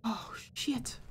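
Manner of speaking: startled curse under the breath; whisper. Real, not acted. Caught by Granny, whispering a curse. Spotted while hiding from Granny.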